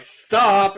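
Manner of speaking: "Stop" pushed loud and high; air-quoted STOP. Shown as a demonstration. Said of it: "air quotes".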